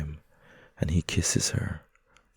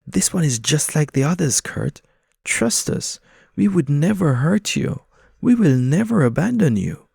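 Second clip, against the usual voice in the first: coaxing pigeons; 9 semitones higher, 5 dB louder than usual. Acted. Pigeons coax Kurt.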